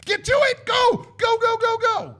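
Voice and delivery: high, strained rapid-fire yell; hurried shouting. Acted. Mocking modern movies' hurry, shouted urgently. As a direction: shout it like an impatient hype man.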